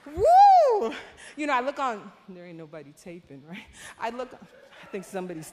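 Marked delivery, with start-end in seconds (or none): 0.1-0.9 high, loud shout of joy; a sudden burst of praise; joyful whoop. Real, not acted. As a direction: let out an ecstatic whoo. Joyful cry about meeting God.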